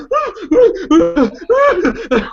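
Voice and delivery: squealing overjoyed laugh; high excited laugh. Acted. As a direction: burst into a high joyful laugh. GM voices overjoyed creature.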